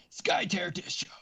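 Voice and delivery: high, slow, drawn-out announcer call; wrestling announcer voice. Pretend. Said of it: "like, a wrestling announcer voice".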